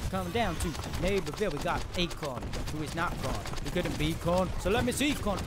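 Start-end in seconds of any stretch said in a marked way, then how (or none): none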